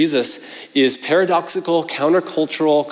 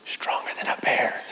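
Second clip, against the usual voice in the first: breathy whispered secret request; 8 dB quieter than usual. Retold or imitated. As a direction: lean in and whisper it like a bedtime secret. Son whispers request at bedtime.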